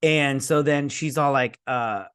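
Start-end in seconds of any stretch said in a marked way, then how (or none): none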